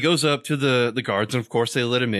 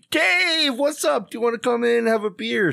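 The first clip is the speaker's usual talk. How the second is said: delighted greeting; 13 semitones higher than usual. Acted. Tom Cruise to his pal Dave at the door, delighted. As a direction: greet him in a high, excited call. Acted greeting of an old friend.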